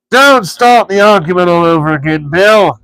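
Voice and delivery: troll Bert scolding William. A narrator's reading. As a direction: grumble at Bill like a cranky troll. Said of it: "he said".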